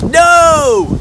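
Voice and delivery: high loud protest; shouted no. Real, not acted. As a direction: yell it in dread. Dad dreading gunge.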